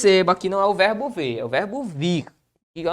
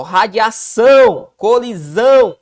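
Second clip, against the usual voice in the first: radiação, colisão said slowly and louder, leaning on -ção and -são; drawn-out stress on the last syllables; 2 semitones higher, 7 dB louder, slower than usual. Shown as a demonstration. Teacher showing students the oxytone stress, slow and louder. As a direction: stretch radiação and colisão to show where the stress falls. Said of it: "de fato são oxítonas".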